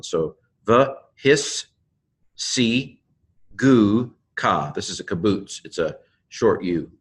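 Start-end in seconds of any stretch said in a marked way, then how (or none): 0.6-4.7 slow "the his si gu ka", a pause after each part; syllable by syllable. Shown as a demonstration. Teacher showing a doubled consonant, syllable by syllable. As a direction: close "his" on s, then begin "si". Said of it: "second scene begins the next one".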